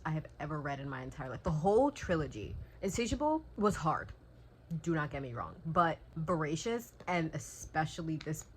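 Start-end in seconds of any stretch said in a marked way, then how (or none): none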